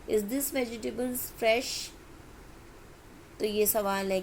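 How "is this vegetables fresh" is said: slow question pitched higher; question intonation. Shown as a demonstration. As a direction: ask it like a genuine question. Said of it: "हम question बना सकते हैं". (सवाल वाले लहजे में धीरे से)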